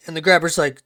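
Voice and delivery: ordinary talk, nothing marked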